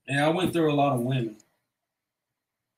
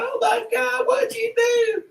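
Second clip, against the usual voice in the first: put-on much higher panicked girlfriend voice; freaking out; slower than usual. Girlfriend sees his bleeding arm, high panicked shriek. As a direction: shriek in alarm at the blood. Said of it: "She's freaking out".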